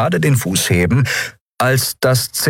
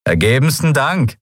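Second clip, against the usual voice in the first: mock gratitude; 8 semitones higher than usual. A narrator's reading.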